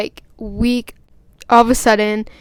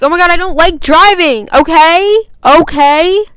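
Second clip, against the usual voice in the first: loud, higher exasperated protest; indignant, raised-voice whine ending on insistent questions; exasperated outburst; 6 semitones higher, 8 dB louder than usual. Acted. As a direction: blurt it out, fed up and defensive. Exasperated outburst about disliking driving.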